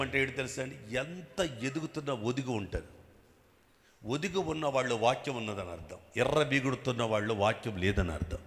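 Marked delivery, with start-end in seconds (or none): none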